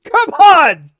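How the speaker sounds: loud, high laughing protest. Real, not acted. Protesting to chat between laughs, laughing. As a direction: throw it out mid-laugh.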